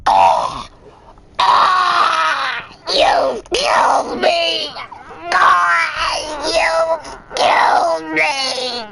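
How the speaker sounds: loud, high, slow mock wailing; the thief flops down and wails melodramatically; hammed-up fake dying. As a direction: ham up a fake death cry. Woman fakes death, mocked.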